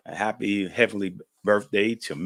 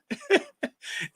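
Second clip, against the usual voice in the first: laughing; 5 dB quieter than usual. Real, not acted. Laughing at Meemaw story.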